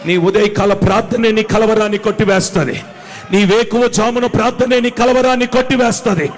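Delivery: from steady to loud, rising fervour; building preaching. Acted. Preacher urging morning prayer, building to a loud cry.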